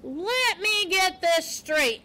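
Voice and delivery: high, slow, drawn-out disbelief; incredulous porcupine. A narrator's reading. Porcupine checking Poppy's unlikely story, incredulous.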